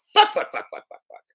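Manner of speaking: mock-frustrated whispered swearing; whispered cursing. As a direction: whisper a string of curses under your breath. Acts out frustration at finding nothing.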